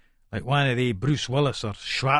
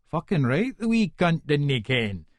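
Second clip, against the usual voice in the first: plain reading voice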